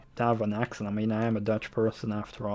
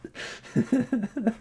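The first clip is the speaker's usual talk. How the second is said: real burst of laughter; 11 semitones higher than usual. Real, not acted. Laughs after joke.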